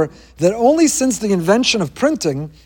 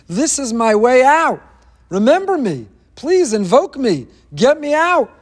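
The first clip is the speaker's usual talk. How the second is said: higher, pleading prisoner's hope, slowed; Yosef pleading; 6 semitones higher, 3 dB louder, slower than usual. Acted. Imprisoned Yosef begs the butler, higher and pleading. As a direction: beg to be remembered.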